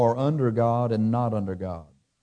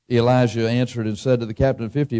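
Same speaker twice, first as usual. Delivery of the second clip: ordinary talk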